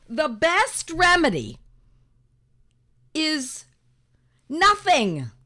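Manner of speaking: slow, weighted, then hit nothing hard; emphatic reveal. Acted. Teacher lands the punchline for her friends, loud and slow.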